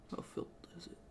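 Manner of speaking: unvoiced aside, barely audible; whisper to self. Real, not acted. Checking his water cup, a muttered whisper. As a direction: whisper it while peering into the cup.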